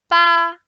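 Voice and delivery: "ba" high, level and loud; sustained high level "ba"; first tone. Shown as a demonstration. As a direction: hold "ba" on a high flat pitch. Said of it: "With four tones".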